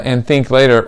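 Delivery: usual speaking voice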